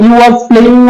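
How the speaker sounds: slow, careful model sentence for the class; deliberate teacher demo. Shown as a demonstration. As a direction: spell the sentence out slowly for students. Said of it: "তো যখন singular বোঝাবে".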